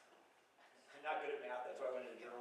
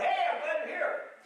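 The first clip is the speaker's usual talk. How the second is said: put-on higher, bright welcome from an elderly aunt; cheerful old aunt greeting; 9 semitones higher, 4 dB louder than usual. An elderly aunt warmly welcomes her visiting family, pitched higher.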